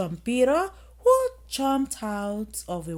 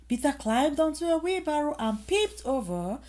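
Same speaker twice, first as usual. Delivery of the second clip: usual speaking voice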